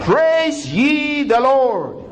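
very slow, high, stretched praise. Acted. Pastor repeating the call to praise, very slow and high. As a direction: draw every word out long and high. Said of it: "repeated praise call".

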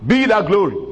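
high, ringing call of praise; fervent praise. Acted. Pastor praising God before the church, high fervent call.

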